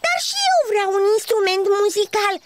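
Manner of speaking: high, fast, cross whine; getting cross. Acted. Ladybird demanding an instrument, fast cross whine. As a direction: ladybird wants her own. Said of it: "se enervă Buburuză".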